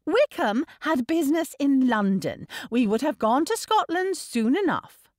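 put-on high, defensive girl; defiant retort. A narrator's reading. Niece defending her elopement to uncle, defiant. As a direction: argue back, sure you are right. Lydia argues back at uncle.

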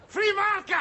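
loud, high bellowed slogan; drunken shouting. Acted. Drunk bawling a slogan in the street at night, loud shout. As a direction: roar it out like a drunk at closing time.

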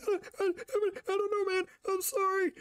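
very high stammering panic, imitated; mock terrified stutter; scared roommate. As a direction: squeal a frightened apology. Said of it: "just scare the shit out of him".

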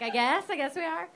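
mock uncertain audience reply; half-hearted agreement. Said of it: "you're like".

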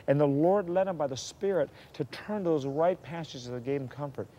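normal talking voice

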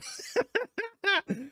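sudden high burst of laughter; real embarrassed laugh. Real, not acted. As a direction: laugh at the blunder. Laughing at own editing mistake.

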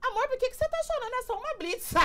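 put-on high-pitched puzzled boyfriend quote; imitated boyfriend. Boyfriend asking his crying girlfriend at the checkpoint, imitated high voice. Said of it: "Aí ele abre a porta e fala assim".

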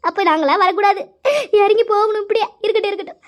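high, sobbing lament; high-pitched crying complaint; tearful wailing. Acted. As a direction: wail out grievances through tears. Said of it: "எதுக்கு இப்ப நீ அழுவுற".

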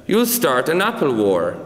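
ordinary delivery, nothing marked

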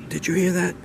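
high, anxious question; urgent bride. Acted. Bride waking her husband again, high and urgent. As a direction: pitch it up high, pressing.

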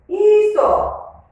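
triumphant high cheer; excited. Real, not acted. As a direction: burst out high and loud in triumph. Teacher finds the right match.

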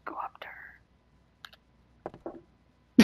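slow hushed whisper. Real, not acted. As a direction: breathe the dare softly. Whispered dare.

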